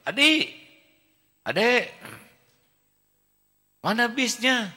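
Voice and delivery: put-on high, loud little child calling; child's voice. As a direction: call out like an eager small child. Child Ade calls out. (suara anak kecil tinggi dan keras)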